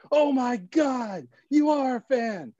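high, slow delighted gasp; delighted surprise. Retold or imitated. Neil realizes the narrator is a fan, high delighted gasp. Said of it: "He goes".

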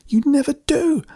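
very high, furious shriek; high-pitched, upset outburst; accusing shriek. A narrator's reading. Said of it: "Amanda shrieked".

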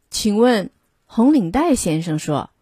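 ordinary delivery, nothing marked

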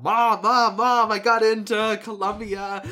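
loud, high, stumbling over the names; a son yelling his admissions news to his mom, breathless and thrilled; excited yelling to mom. Retold or imitated. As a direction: shout the news up the stairs, tripping on words. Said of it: "yelling to my mom".

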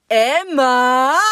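loud, high call across the house; mum calling out. Retold or imitated. Mum calls daughter from the kitchen, loud high call. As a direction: call her name through the whole house. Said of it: "in so einem Ton".

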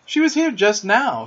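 a little impatient. A narrator's reading. Peter searching for his fairy, a little impatient. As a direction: insist with a touch of impatience. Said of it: "he said, a little impatiently".

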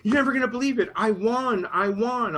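imitating a thrilled prize winner, pitched high; excited. Friend phoning to say she won the contest, excited. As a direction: gush the news as the excited friend. Retelling friend's news of winning.

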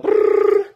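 buzzing brrr like a phone ringing; phone ring imitation. Imitating his phone ringing, an unvoiced buzz. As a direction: make the phone ring with your lips.

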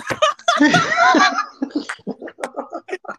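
loud, high squealing laugh; helpless laughter. Real, not acted. Guest cracks up at own Italian accent, helpless high laughter. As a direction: burst out in long, high-pitched laughter.

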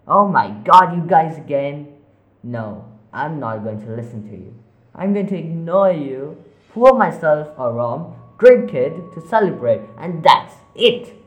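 loud, fed-up retort; exasperated shout. Acted. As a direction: shout them down. Arguing with voices.

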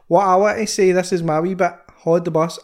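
normal talking voice

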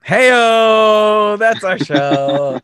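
high loud Hey-o, then a slow that's our show; mock host sign-off. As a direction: ham up a talk show host closing.

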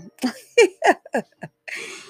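high, loud burst of laughter; laughing. Real, not acted. Pisces host amused at her own shout-out, high laugh. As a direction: laugh out loud, pitched well up.

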